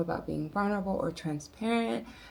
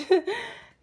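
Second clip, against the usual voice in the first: bursts into a high-pitched laugh; 8 dB louder than usual. Real, not acted. Laughing at her airport sandwich.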